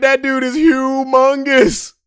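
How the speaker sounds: high, loud, slow amazement; awed. Retold or imitated. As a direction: stretch out humongous. Opponent towering over friend.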